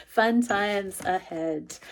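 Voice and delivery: slow laughing promise. Real, not acted. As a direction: chuckle it out slowly. Laughs then teasing line.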